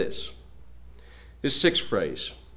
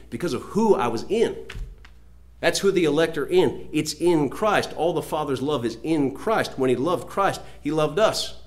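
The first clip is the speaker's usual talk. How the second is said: raised, higher preaching voice; emphatic preaching; 6 semitones higher, 5 dB louder than usual. Real, not acted. Preacher telling the congregation the elect are in Christ, emphatic preaching. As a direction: preach it forcefully, pressing each point.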